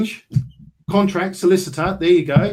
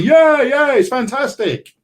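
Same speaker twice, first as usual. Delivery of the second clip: mock hype; 10 semitones higher, 5 dB louder, slower than usual. Mocking everyone else's hype.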